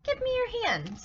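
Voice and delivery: high, firm command; a lightly voiced commanding Jesus. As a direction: call him over with authority. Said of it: "Jesus commanded".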